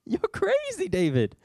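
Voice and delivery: high, loud mocking incredulity, squeaking at the end; exasperated king. King Saul telling David he's crazy, high mocking exasperation. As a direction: build to a shrill "you're crazy".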